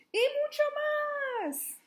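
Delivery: high, slow, drawn-out cry; big exclamation. Acted. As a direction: stretch it out with delight. Dramatic story exclamation.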